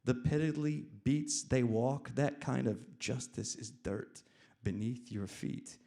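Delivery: loud, emphatic narration; loud and intense storytelling; dramatic story reading. A narrator's reading. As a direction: declaim the story with force. Reads own story forcefully.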